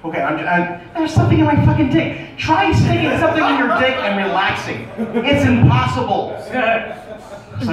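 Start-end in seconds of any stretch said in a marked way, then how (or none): 0.9-6.3 exasperated. Real, not acted. Indignant comic rant. 6.5-7.7 slow breathing. Retold or imitated. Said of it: "I'm just breathing".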